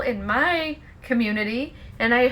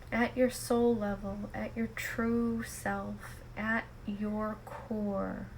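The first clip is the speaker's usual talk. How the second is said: guided meditation; 7 dB quieter than usual. Acted. Host guiding listeners to let go and just be, guided meditation. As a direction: lead them gently into stillness, leaving long pauses.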